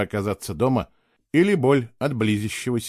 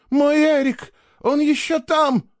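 high, slow panicked wail; hysterical scream; 4 dB louder, slower than usual. Acted. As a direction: cry it out in desperate panic. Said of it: "истерически кричала какая-то женщина".

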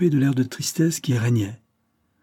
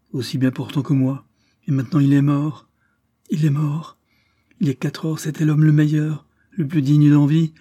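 plainte qui monte, plus haute et plus forte, puis ralentit; lamentation éplorée; 4 semitones higher, 4 dB louder than usual. Acted. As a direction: se lamenter au bord des larmes. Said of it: "en fondant en larmes". (Lord Louis pleure son frère, lamentation montante)

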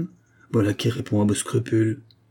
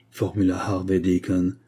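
ordinary delivery

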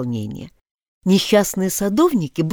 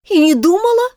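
put-on girl's retort; 12 semitones higher, 5 dB louder than usual. A narrator's reading. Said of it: "отвечала Алиса".

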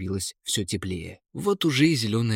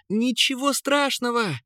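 high-pitched call over the wind; shouting over wind; 13 semitones higher than usual. A narrator's reading. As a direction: raise the voice high to carry over rushing air. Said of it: "перекрикивая шум ветра". (перекрикивая шум ветра)

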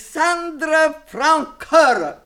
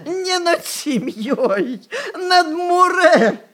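voice breaking; 6 semitones higher, slower than usual. Acted. Grandmother correcting her accuser, voice breaking. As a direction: correct them with the voice cracking.

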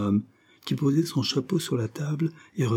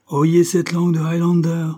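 higher, louder mock-indignant cry; exclaiming; 7 semitones higher, 7 dB louder than usual. A narrator's reading. Father mock-scolding the suitor before his daughter, loud exclamation. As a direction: call it out loud in teasing outrage. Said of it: "s'écria monsieur de Bassompierre".

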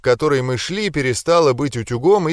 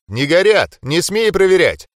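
loud forbidding shout; stern shout; 5 semitones higher, 4 dB louder than usual. Acted. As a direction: bark the order. Forbidding a dangerous test.

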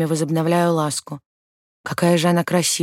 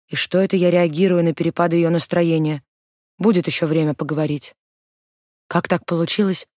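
usual speaking voice

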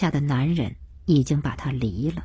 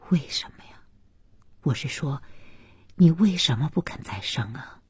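softly voiced older man; gently pressing question; elderly father, puzzled; 2 semitones lower, 5 dB quieter than usual. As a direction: ask quietly and carefully.

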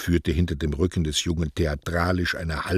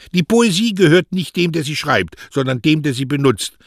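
much higher, louder, stress on der; defiant maxim; 10 semitones higher, 8 dB louder than usual. A narrator's reading. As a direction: proclaim it defiantly. (trotzig, mit Betonung auf der)

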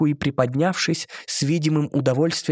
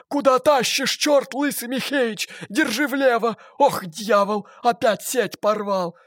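fisherman's shout; 10 semitones higher, slower than usual. A narrator's reading. Mermaid shouting curses overheard from fishermen. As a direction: bark it like a rough fisherman. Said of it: "крикнула своими коралловыми губками".

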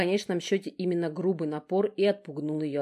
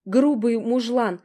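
indignant; 7 semitones higher, 6 dB louder than usual. A narrator's reading. Younger sister dismissing Gena, high indignant scorn. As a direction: sneer at the brute.

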